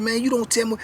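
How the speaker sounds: bristling back at him, hammed-up; retold retort. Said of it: "Then he said".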